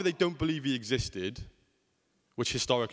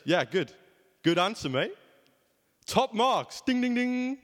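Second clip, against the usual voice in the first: Jesus as a game-show host, higher and louder; playful game-show Jesus praising the right answer; cheery quiz host; 9 semitones higher, 3 dB louder, slower than usual. Retold or imitated. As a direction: ham up the quiz-show praise, rising to "ding, ding, ding".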